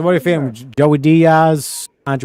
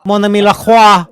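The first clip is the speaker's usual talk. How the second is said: put-on French accent; 4 semitones higher, 4 dB louder, slower than usual. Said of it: "you need an American accent".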